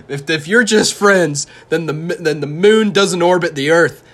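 high, loud, mocking laugh through the words; laughing mockery. Ridiculing his ex's "just friends" excuse with a loud mocking laugh.

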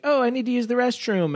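put-on high-pitched kid announcing a need; voiced child. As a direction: imitate a child piping up from the car. Voices a kid on road trip.

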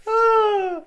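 very high sung ah; theme song cry. Pretend. Host finishing the DuckTales theme, with a very high sung ah.

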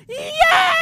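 high, loud cheer of joy; excited yes. Retold or imitated. Fan at home cheering a heel turn, loud excited shout. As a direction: yell it like your favourite just turned heel. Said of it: "I was like".

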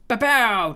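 loud, high sound-effect burst; excited fanfare. Acted. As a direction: announce it like a power-up.

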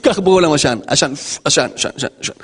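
defiant sinner. Acted. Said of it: "אז פשע זה".

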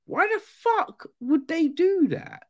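very high, squeaky disbelief; comically high-pitched, incredulous outburst. Retold or imitated. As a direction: question it in baffled falsetto. Acted reaction to absurd plot.

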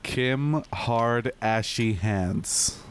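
drawn-out deadpan delivery; very slow punchline. Acted. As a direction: drag every word out. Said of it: "No puedo, cabrón, está bien mierda".

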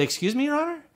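very high, polite disbelief; squeaky retold puzzlement; baffled. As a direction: question the judge in bewilderment. Retold baffled reply to judge.

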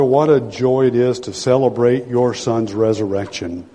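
ordinary talk, nothing marked

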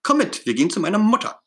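high-pitched rush of words; hurried child's voice, pitched right up; quick, very high voice. A narrator's reading. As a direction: blurt it out fast and high. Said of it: "ganz schnell und mit ganz hoher Stimme".

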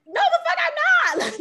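high, loud, slow retort; indignant shout. Retold or imitated. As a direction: shout it back, outraged. Retelling her retort to people.

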